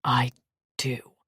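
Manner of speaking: low short grunt. Acted. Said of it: "Maddock grunted".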